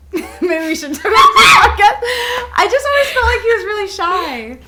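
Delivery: flustered laughing backpedal; embarrassed laughter. Real, not acted. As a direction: laugh nervously to cover a gaffe. Embarrassed after insulting friend's boyfriend.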